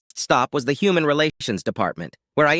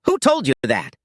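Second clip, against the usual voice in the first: higher-pitched, mild narrated scream; screamed demand; 5 semitones higher, 3 dB louder than usual. A narrator's reading. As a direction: demand it in a half-raised cry. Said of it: "Becky screamed".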